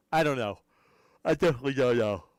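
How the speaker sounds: words stretched by a real yawn; yawning through words. Real, not acted. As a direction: talk right through a yawn. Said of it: "I can't stop yawning".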